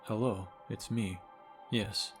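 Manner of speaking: normal talking voice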